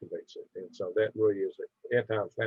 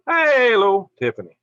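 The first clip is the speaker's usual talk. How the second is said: much higher and louder bright hello; excited greeting; 11 semitones higher, 9 dB louder than usual. Real, not acted. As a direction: greet her like a friend just walked in.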